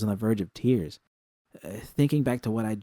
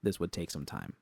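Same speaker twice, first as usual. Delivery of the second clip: ordinary delivery, nothing marked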